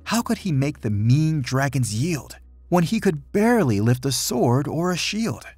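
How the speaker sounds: plain reading voice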